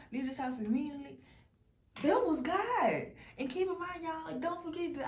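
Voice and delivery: fired up, testifying; excited. Real, not acted. Excited testimony about God.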